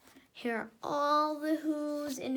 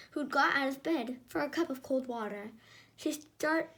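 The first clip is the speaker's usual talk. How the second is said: plain reading voice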